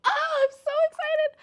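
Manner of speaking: very high squeal of excitement; real giddy shriek; excited squeal. Real, not acted. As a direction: squeal it like you can't contain yourself.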